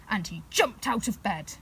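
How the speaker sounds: usual speaking voice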